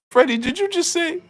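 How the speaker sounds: high, squeaky challenge; put-on high voice demanding an answer; mock Robert Garcia. Robert Garcia challenging Freddie, high and squeaky. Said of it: "He said".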